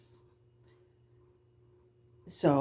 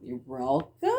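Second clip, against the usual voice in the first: puzzled reply; 9 semitones higher, slower than usual. Retold or imitated. Mother unexpectedly thanks her.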